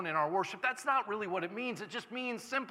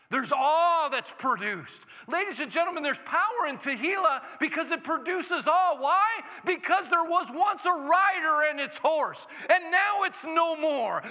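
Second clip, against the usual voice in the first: full-force preaching; 10 semitones higher, 5 dB louder than usual. Acted. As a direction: preach it out with everything.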